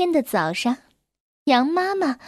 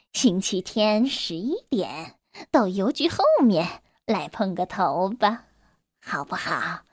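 a hint of a sly wolf coaxing the lamb; soft letter voice; 5 semitones lower, 4 dB quieter than usual. Wolf coaxing lamb by letter, low and soft. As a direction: lower and softer, reading a sly letter.

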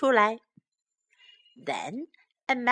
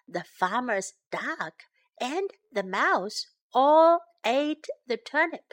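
usual speaking voice

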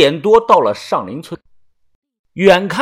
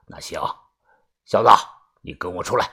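much lower and softer elder; gruff old man; 8 semitones lower, 3 dB quieter, much faster than usual. A narrator's reading. Grandpa summons the narrator outside, low old-man voice. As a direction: call the boy out curtly.

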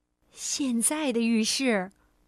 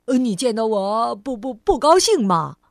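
cheerful stammer; 7 dB louder than usual. Acted. Cheerful clumsy cousin continues.

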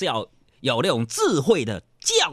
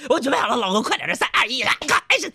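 急切的媳妇; 11 semitones higher, 3 dB louder, faster than usual. Retold or imitated. 媳妇急着开始.

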